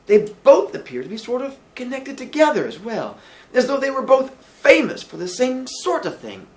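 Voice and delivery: high, loud, halting Billy voice; earnest, puzzled Billy. Acted. Billy explains linked names to landlady, puzzled.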